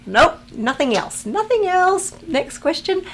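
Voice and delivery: high, loud, playful denial; mock dismissal. Reading a viewer's question aloud and playfully refusing it, mock dismissive. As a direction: jump up high to shut the question down.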